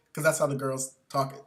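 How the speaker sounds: usual speaking voice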